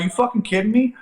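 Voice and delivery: high-pitched incredulous yell; mock-furious you kidding me; angry outburst. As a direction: whirl round and snap at the guy. Angry retort to shoulder check.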